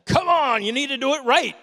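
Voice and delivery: high, loud mock yell; mimicking yelling preacher. Young man imitating his childhood preacher yelling, mock shout. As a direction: bark it like an old-time shouting preacher. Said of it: "the preacher would just yell at us".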